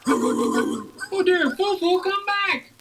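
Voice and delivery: high put-on diva voice calling out; Miss Piggy calling. Piggy calls her poodle back, high and dismayed. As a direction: call after the fleeing poodle in dismay. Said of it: "Piggy ran after him".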